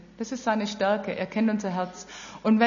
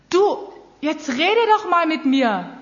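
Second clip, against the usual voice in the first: higher, louder and slow, pleading invitation; God calling a person to talk, louder and slowly drawn out; God's inviting voice; 5 semitones higher, 5 dB louder, slower than usual. Pretend. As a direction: lean in and coax gently but audibly, slowly. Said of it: "wenn er mir anbietet".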